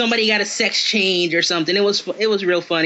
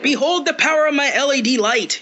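higher, mock-epic wizard proclamation; grand Gandalf parody; 6 semitones higher than usual. Gandalf in the riff lifts his staff, mock-grand proclamation. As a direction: raise the staff and boom it out in jest. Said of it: "he's like".

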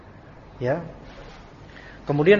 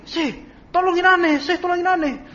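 frantic plea; 12 semitones higher than usual. Retold or imitated. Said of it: "ada syekh lagi".

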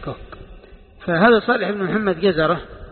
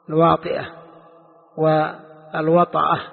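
each word drawn out slowly; teacher dictating slowly; slow spelled-out words. Shown as a demonstration. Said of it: "ويقال لهم أيضًا الواطئة والوطأة".

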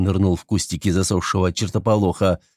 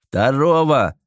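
barked order; 5 semitones higher, slower than usual. A narrator's reading. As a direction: bark the order over battle noise. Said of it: "рявкнул командир десанта".

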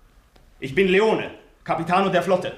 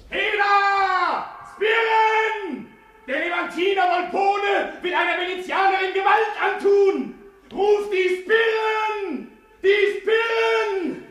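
calling for the guards; 7 semitones higher, slower than usual. Acted. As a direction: call it out the window to the street.

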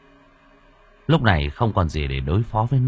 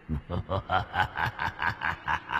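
low, soft creepy chuckle; eerie ghost laugh; 4 semitones lower, 12 dB quieter than usual. A narrator's reading. Water ghost laughing at fleeing Thắng, low and creepy.

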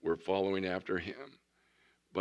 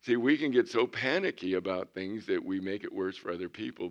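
usual speaking voice

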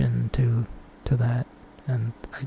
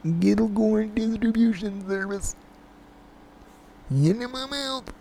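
high prank caller voice; deadpan weird caller, high; 8 semitones higher than usual. Pretend.

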